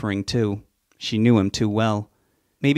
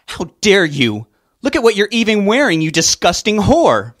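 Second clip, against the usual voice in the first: very high, much louder, screaming insults; furious yelling; 10 semitones higher, 9 dB louder than usual. Acted. Mother screams at Sue at the podium, furious. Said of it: "Veronica yelled out".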